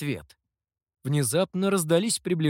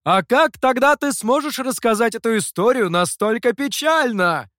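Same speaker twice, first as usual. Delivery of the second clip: loud, high teasing retort; the master yells back a teasing answer; shouted back; 8 semitones higher, 7 dB louder than usual. A narrator's reading. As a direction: holler it back over the shoulder. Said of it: "крикнул в ответ мастер".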